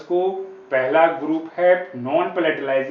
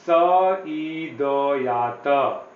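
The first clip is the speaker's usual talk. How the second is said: स ई द या त read slowly and apart; reading the letters one by one, very slow; much slower than usual. Shown as a demonstration. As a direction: spell it out very slowly. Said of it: "इसको अगर हम पढ़ेंगे".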